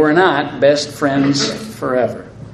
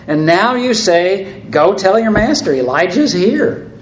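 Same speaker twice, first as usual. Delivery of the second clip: raised, slowed disbelief quoting the order; incredulous plea; 5 semitones higher, 4 dB louder than usual. Acted. Obadiah balks at telling Ahab Elijah is here, incredulous. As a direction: hold up the words as unthinkable. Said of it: "he repeats himself".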